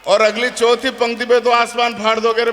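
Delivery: ordinary delivery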